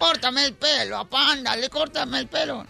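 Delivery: voz aguda y lenta de niño suplicando; niño insistente. Acted. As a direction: como un niño chiquito que ruega a su papá. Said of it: "Llega un niño de cinco años". (niño de cinco años rogando, voz aguda)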